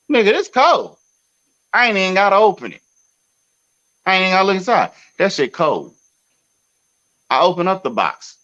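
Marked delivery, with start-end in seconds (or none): 0.1-5.9 hammed-up retold outrage; mock outrage. Telling the delivery man the pizza is cold, high and indignant. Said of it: "I said".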